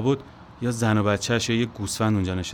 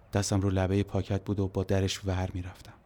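plain reading voice

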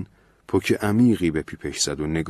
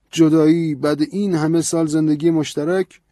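higher, louder, insistent priest; lecturing priest; 9 semitones higher, 6 dB louder than usual. A narrator's reading. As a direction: scold him with the priest's rising fervour. Priest questions Ben.

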